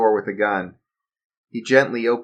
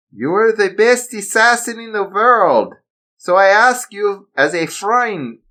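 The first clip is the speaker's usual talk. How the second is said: put-on German accent; 11 semitones higher, 6 dB louder than usual. Villain flattering the assassin, German accent. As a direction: coax him in German accent. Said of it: "He spoke in a German accent".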